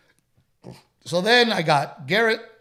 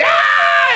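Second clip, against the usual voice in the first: loud, high cheering scream; wild celebratory yell; crowd cheer; 9 dB louder than usual. Retold or imitated. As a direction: erupt like a winning table. Table erupts in cheering.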